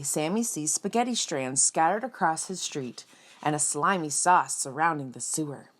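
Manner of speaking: usual speaking voice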